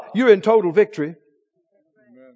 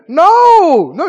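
high, louder mock scream of no; flesh screaming; 7 semitones higher, 6 dB louder than usual. The flesh screaming no at the believer, loud protest. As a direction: protest like a spoiled child. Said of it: "your flesh is screaming".

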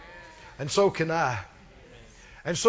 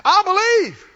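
loud, high burst of faith; crying out; 9 semitones higher, 8 dB louder than usual. Acted. Father suddenly convinced by Jesus' words, crying out. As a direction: shout it out as if struck. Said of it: "They hit him like a truck".

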